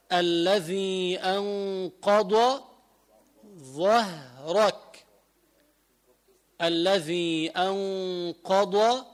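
very slow tajwid recitation; Quran recitation. Shown as a demonstration. Teacher recites Surat al-Sharh in slow tajwid. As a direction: recite it slowly, separating ḍād from ẓā'. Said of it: "صوت الشرح".

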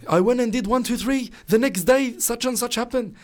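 high-pitched, excited amazement; pitched well up, stunned and eager; retold shock. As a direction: imitate someone breaking astonishing news. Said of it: "he came up to me in shock".

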